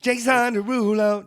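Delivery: excited blurt. Real, not acted.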